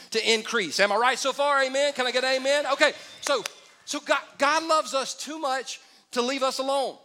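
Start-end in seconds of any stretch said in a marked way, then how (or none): none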